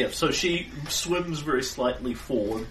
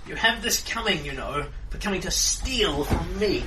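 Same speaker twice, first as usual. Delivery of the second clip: sea hag voice; 7 semitones higher, 4 dB louder than usual. Acted. Hag taunts the party.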